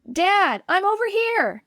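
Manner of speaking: high relieved call; joyful shout. A narrator's reading. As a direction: call out to dad. Calling to rescuer.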